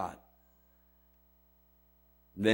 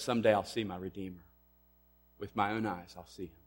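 hushed quote; 4 semitones lower, 8 dB quieter than usual. Acted. Job's hope quoted, fading away.

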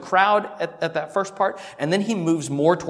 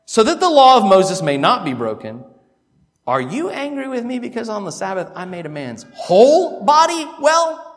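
climactic reading; 5 semitones higher, 4 dB louder than usual. Shown as a demonstration.

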